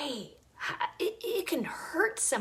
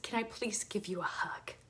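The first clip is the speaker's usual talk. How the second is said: whispered, much softer, gentle offer; 3 semitones lower, 10 dB quieter than usual. Retold or imitated. Offering a grieving stranger a hug, whispered. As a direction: ask it in a hush.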